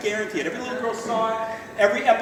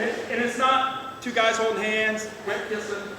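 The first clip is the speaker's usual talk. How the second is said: normal talking voice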